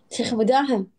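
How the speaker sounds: ordinary talk, nothing marked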